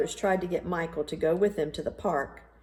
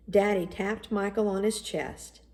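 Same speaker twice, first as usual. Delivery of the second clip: plain reading voice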